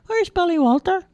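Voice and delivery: put-on high, childlike simple lad voice; a big innocent lad, high and eager; Charlie voice. A narrator's reading.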